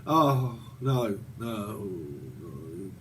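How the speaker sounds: a hint of gloomy despair, fading out; mock low crash. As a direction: sink into a mock depressive slump. Bipolar crash after the high.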